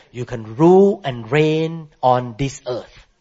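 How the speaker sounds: usual speaking voice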